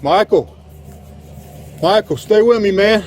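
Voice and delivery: urgent pleading; man down shout. Real, not acted. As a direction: beg him to stay awake. Officer down, urging partner.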